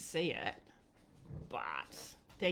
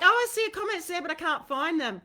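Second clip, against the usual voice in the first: mock exclaiming; 9 semitones higher, 8 dB louder, faster than usual. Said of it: "I'm like".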